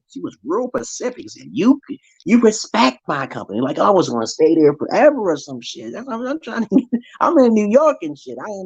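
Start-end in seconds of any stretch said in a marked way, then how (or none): 1.5-3.6 stern mother. Retold or imitated. Mother scolds daughter.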